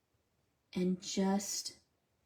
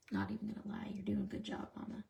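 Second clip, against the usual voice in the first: soft voiceless whisper; 8 dB quieter, faster than usual. Real, not acted. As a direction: whisper the praise aside. Quiet aside to mother.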